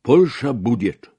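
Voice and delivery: loud, high, slow proclamation; big drawn-out holler in Russian; shouted promise. A narrator's reading. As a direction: call it out slowly across the table. Shouting across table continues.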